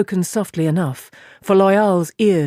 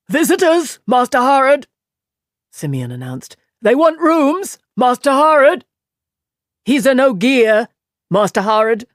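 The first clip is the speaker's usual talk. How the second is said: higher, louder urgent announcing; loud announcement; 7 semitones higher, 6 dB louder than usual. A narrator's reading. Simeon loudly announcing the guests to Master Harrod. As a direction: call it out to wake him.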